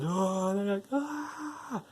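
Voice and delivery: high, thin mock screams; imitated scream. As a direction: scream in falsetto without much volume. Characters scream at sudden lightning.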